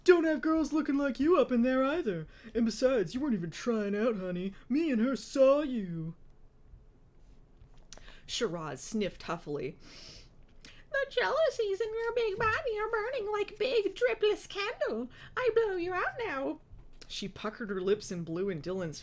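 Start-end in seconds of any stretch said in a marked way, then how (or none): 0.0-6.1 loud, high put-down with attitude; sassy mocking. A narrator's reading. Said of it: "Dylan mocked Shiraz". 10.6-16.6 huffy, very high put-on voice; loud, disdainful falsetto put-down; Shiraz. A narrator's reading.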